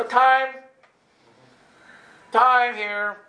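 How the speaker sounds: high, loud interjection; calling time-out. Retold or imitated. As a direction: halt your friend with a loud call. Halts friend with time-out.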